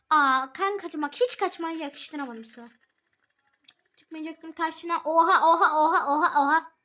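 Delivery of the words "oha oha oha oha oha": loud laughing oha; excited exclaiming. Real, not acted. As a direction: burst out in amazed glee.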